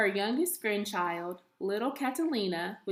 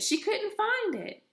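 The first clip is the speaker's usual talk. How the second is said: puzzled surprise; 11 semitones higher than usual. A narrator's reading. Narrator marvels the grandmother can't find her spoon, surprised. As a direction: pitch leaps high on find.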